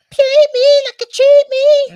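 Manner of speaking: squeaky chipmunk-high singing; helium voice. Pretend. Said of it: "what is that voice?".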